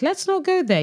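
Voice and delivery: high-pitched mock alarm; mock scolding. Host telling Kirk to drop the subject, high mock scolding. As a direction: shoot up high in playful protest.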